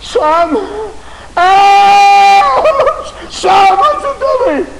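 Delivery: high, loud wail; anguished father's cry. Acted. Father finding his son in hell, anguished wailing. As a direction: cry out desperately to your child.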